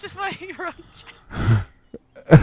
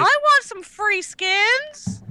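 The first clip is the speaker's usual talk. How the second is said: mock begging; 9 semitones higher, 3 dB louder, much slower than usual. Begging for free skins.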